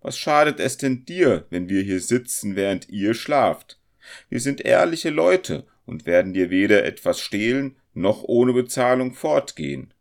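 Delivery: indignant, incredulous guest; astonished. A narrator's reading. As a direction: argue back in disbelief. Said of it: "sprach der Zirkelschmied staunend".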